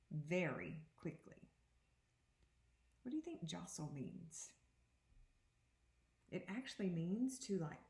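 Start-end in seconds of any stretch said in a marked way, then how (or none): none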